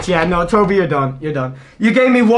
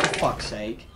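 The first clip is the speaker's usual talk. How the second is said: low, much softer grumble; quiet exasperation; 11 semitones lower, 12 dB quieter than usual. Real, not acted. Player let down by the pack, low and soft exasperation. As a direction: let it drop, deflated. Disappointed by the pull.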